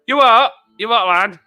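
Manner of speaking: loud, high Yorkshire disbelief; imitated Yorkshire locals. Yorkshire people not understanding a newcomer, loud imitated Yorkshire accent. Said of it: "people going".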